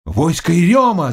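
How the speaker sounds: high-pitched alarm call; crying out. A narrator's reading. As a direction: shout a warning across the boat. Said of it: "закричали на пароме".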